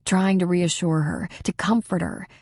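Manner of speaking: ordinary delivery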